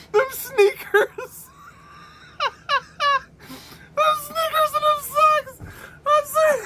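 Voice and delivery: very high squeal, barely getting words out; laughing to tears. Real, not acted. Reviewer losing it over old sneakers, crying with laughter. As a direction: wheeze and sob with laughter between words. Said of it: "I'm tearing up".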